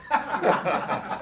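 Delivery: soft breathy laugh; real laugh. Real, not acted. Speaker cracking up at own joke, soft laugh. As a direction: let a quiet laugh slip out.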